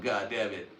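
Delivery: normal talking voice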